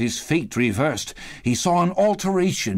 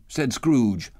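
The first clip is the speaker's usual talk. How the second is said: ordinary delivery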